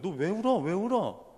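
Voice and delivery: a touch of a mother's alarm; startled mother, imitated. Mother startled by her crying son, lightly acted surprise. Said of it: "어머니가 깜짝 놀라는 거예요".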